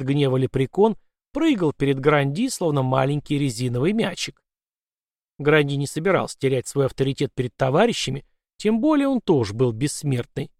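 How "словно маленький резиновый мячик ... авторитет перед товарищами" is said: usual speaking voice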